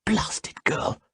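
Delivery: high, whispered curse under breath; muttered. A narrator's reading. Salmataya impatient with her servant, whispered mutter. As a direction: spit it under your breath. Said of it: "she muttered".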